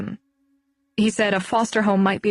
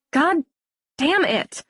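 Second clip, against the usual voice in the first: hoog en fel uitgeroepen, in sarcasme overgaand; boze uitbarsting; 6 semitones higher than usual. Acted. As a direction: scheld het gefrustreerd uit, dan bitter sarcastisch. Angry outburst about cancer secret.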